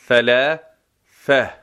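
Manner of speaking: ثلاثة slow, both ث with the tongue between the teeth; slow thalatha. Shown as a demonstration. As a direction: draw out ثلاثة with two interdental th. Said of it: "یعنی دو تا حرف نوک زبانی".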